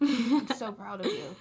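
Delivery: laughing through the compliment. Pretend. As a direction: say it again while giggling. Repeats praise, laughing.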